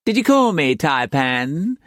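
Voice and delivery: high, sugary landlady voice; syrupy sweet; high, sweet madam answering the Taipan up the stairs; sweetly. A narrator's reading. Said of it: "she asked sweetly".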